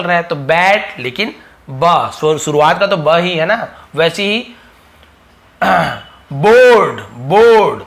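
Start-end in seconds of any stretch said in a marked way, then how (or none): 6.3-7.8 "बोर्ड" loud, with a held o; demonstrating the bo sound. Shown as a demonstration. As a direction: model "बोर्ड" word by word, falling. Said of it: "यहाँ तो बो का ध्वनि". ("बोर्ड" को ज़ोर से, ओ खींचकर)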